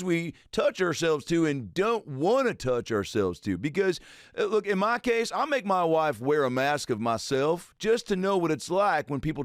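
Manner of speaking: high hammed-up Doctor Phil; Doctor Phil impression. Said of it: "Doctor Phil versus George Bush Senior".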